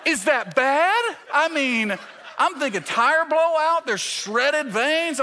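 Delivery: high, loud comic panic; mock alarm. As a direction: play up the horror, stretching the key words. Acted alarm at blown vein.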